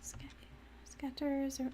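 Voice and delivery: usual speaking voice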